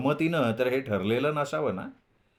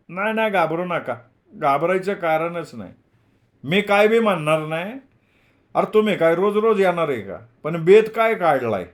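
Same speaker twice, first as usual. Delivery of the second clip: raised, louder voice of a reassuring landlord; genial landlord; 5 semitones higher, 5 dB louder than usual. A narrator's reading. Landlord tells the visiting boys not to worry, hearty and raised. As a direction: pitch up, hearty village landlord.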